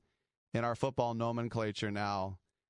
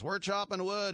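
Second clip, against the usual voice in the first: coach hype; 8 semitones higher than usual. Retold or imitated. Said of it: "and he goes".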